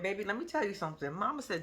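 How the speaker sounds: usual speaking voice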